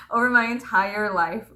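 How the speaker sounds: normal talking voice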